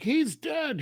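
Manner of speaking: put-on high, urgent girl's voice; urgent Melody. Retelling Melody waking the pilot, high urgent voice. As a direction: hurry him, pitched well up. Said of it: "And she's like".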